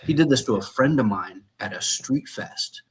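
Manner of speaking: usual speaking voice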